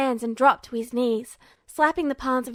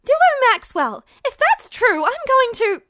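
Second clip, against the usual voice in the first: furious shriek; 12 semitones higher, 9 dB louder than usual. A narrator's reading. Girl threatening Duo, high furious shriek. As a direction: shriek his full name as a warning. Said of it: "Hild's voice went up in a shriek".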